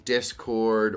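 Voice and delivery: ordinary delivery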